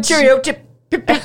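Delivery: high, put-on British cheeriness; mock British chipper. Host mocks the Invisible Man's sign-off, high chipper British voice. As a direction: send up a chirpy Englishman in a high voice.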